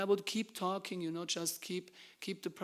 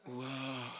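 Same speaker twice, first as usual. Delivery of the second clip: long, drawn-out spellbound wow; mock hypnotized wow; 3 semitones lower, 3 dB quieter than usual. Book distributor imitating a hypnotized man on the street, entranced. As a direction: imitate someone in a trance. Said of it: "He was, like, hypnotized".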